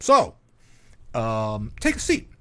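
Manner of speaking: hammed-up stern TV host; Chris Hansen impression. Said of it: "Chris Hansen would come out and be like".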